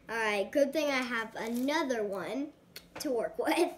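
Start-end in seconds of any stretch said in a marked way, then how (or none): none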